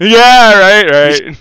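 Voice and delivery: loud laughing yeah; half-laughed agreement. Real, not acted.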